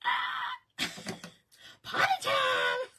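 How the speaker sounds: high, loud playful whoop with a laugh in it; hammed-up cheer as if the party just started; mock party shout. Greeting the stranger on the plane, mock party shout.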